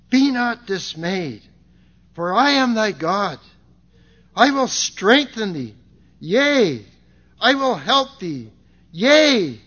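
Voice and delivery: measured phrases with swelling key words; emphatic scripture reading. Real, not acted. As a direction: proclaim the verse, pausing between promises. Reads scripture aloud.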